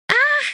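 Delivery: short high-pitched shriek; loud, piercing little scream. A narrator's reading. Woman learning her roommate is downstairs, loud high shriek. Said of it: "I shriek".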